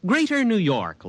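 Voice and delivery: high-pitched, exasperated disbelief; incredulous objection. Acted. Man objects to far-off danger reports, incredulous.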